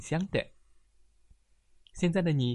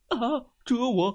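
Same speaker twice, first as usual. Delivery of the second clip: alarmed bear; 12 semitones higher, 6 dB louder than usual. Acted.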